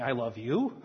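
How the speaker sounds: softly mocking "I love you" with a laugh, "you" rising; insulting I love you. Shown as a demonstration. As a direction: say "I love you" as a backhanded jab. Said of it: "kind of insulting".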